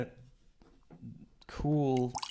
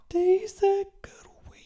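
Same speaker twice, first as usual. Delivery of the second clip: whispered; 4 dB louder than usual. Pretend. Echoes title in whisper.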